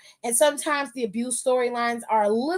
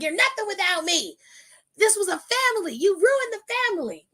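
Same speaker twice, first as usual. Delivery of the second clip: higher, whiny mock holler; 7 semitones higher than usual. Retelling Gable yelling at his team, mock holler. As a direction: play the hurt bully, lightly. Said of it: "hollering like".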